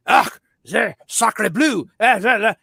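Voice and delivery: slow, hammed-up French gibberish; jabbering in a fake French accent; mock French accent. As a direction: put on a silly French accent. Mock French chatter in film.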